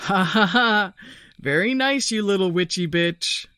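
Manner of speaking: taunting the hidden friend, trying to sound brave; croaking bravado. A narrator's reading. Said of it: "Michael croaks".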